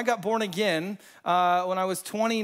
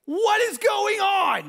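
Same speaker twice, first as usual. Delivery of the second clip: high, loud, drawn-out disbelief; bewildered outburst; 7 dB louder, much slower than usual. Retold or imitated. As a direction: re-enact a freaked-out shout. Retells being freaked out.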